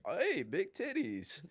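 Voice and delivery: high, drawn-out comedian aside; high, slow mock heckler; Jim Gaffigan. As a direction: pipe up slowly in a silly voice. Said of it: "and then he's like".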